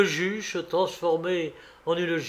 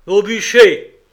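loud, higher shouted command; judge's shout; 6 semitones higher, 13 dB louder than usual. A narrator's reading. Judge condemning a man to the stake, shouting. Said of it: "cria une voix, la voix du juge".

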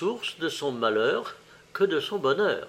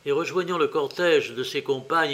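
ordinary delivery, nothing marked

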